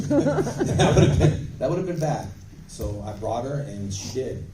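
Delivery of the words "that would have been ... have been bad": laughing. Real, not acted. Laughs at idea of firing daughter.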